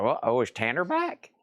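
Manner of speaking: a hint of eager dog voice, lifting in pitch; lightly voiced hopeful dog. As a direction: lightly mimic a dog perking up at the door. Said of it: "He hears the door chime".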